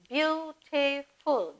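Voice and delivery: teacher stretching a word for the class; slow, drawn out. Shown as a demonstration. Counting syllables of words aloud.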